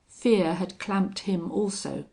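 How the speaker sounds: ordinary delivery, nothing marked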